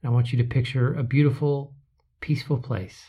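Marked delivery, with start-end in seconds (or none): none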